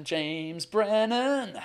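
very slow, stretched-out name; drawn-out name call. Real, not acted. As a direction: draw each word right out, holding the last syllable. Calling up the next guest, stretching it.

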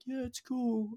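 high, soft, bored shrug of a line; mimicking an artist who hides all excitement; mock unimpressed. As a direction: toss it off like it means nothing. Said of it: "they're just like".

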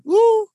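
high, loud woo of alarm; a hammed-up high woo; mock woo. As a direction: yelp woo in mock shock. Reacting to God told me.

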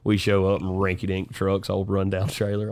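ordinary delivery

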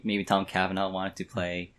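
ordinary delivery

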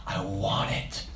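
breathy whisper, slow; a soft, slow whisper of wanting; whispered craving. Acted.

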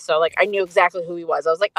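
ordinary talk

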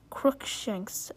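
usual speaking voice

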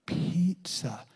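soft breathy Pizza; little girl finally saying the word; whispered child's try. Retold or imitated. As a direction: whisper it proudly like a toddler. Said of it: "she would go".

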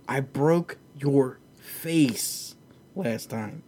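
very slow, flat-out unimpressed delivery; slow, calm put-down; deadpan calm. Retold or imitated. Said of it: "Kamaru just looks at him and goes".

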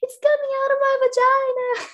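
high, loud squealed announcement; so excited. Retold or imitated. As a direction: shriek the news in hammed-up elation. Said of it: "I was just so excited".

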